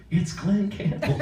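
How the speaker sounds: high, drawn-out imitation, awestruck; stunned phone-answerer, high and stretched out; starstruck mother-in-law. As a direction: mimic her, slow and high, about to hit the floor. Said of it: "my ex-mother-in-law answers the phone".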